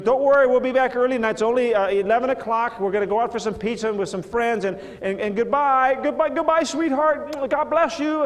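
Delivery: high, loud and bubbly, quick farewell; cheery goodbye. Acted. Daughter calling goodbye to her parents on her way out, high and excited. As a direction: rush out the door calling back happily. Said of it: "and he goes".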